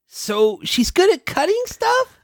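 high excited filly voice; chirpy young pony. Acted. Voicing Crusaders.

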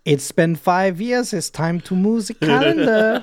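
mock ceremony voice. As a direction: solemnly declare it's calendar moving day. Mock talking to the calendar.

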